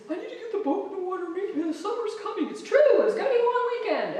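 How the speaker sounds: high, fast, mock-urgent husband voice; husband's urgent demand. Imitating her husband rushing to launch the boat, mock-urgent and high. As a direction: ham up his rush to get the boat in. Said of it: "he said".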